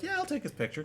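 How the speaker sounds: high, soft mock tourist voice; small, high voice going along with it; imitating obliging tourist. Tourist agrees to photograph the ape, high and meek. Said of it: "And the tourist is like".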